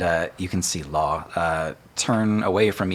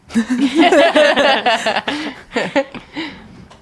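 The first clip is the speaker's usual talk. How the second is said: high burst of real laughter; big laugh; 9 dB louder than usual. Real, not acted. GM cracks up at his own line, loud laugh.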